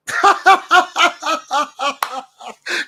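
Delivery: high, loud burst of cackling laughter; mocking laugh. Real, not acted. Streamer reacting to a threatening message, bursts out laughing. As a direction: crack up loudly in derision.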